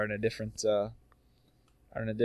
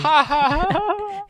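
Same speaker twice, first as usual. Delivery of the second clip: very high, loud fake cackle; hammed-up laugh in the face of danger; mock laughing; 7 dB louder, much slower than usual. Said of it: "then you start laughing".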